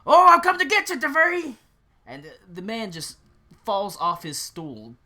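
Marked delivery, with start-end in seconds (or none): 0.1-1.6 high, booming mock-menacing yell; loud high-pitched prankster's yell; yelled scare. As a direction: yell like a bogeyman pouncing on his victim. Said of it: "and he yells".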